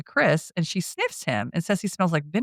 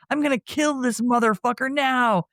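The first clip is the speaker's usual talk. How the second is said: high-pitched retold angry yell; mock rage without much volume; imitated yelling; 8 semitones higher than usual. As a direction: act out a threat, voice pitched way up. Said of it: "while yelling".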